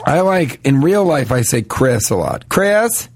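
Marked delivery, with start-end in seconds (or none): 2.5-3.1 Southern twang. Shown as a demonstration. Friends teasing, [name] in a Southern twang. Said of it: "you say it with a southern twang".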